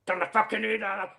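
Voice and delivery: shouting down an order; mock barked order. Warrant officer ordering the radar off, mock bark. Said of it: "came out".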